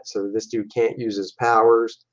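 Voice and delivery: ordinary talk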